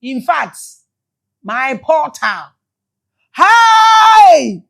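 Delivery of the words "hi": emotional cry. Real, not acted. Overcome with emotion, crying out.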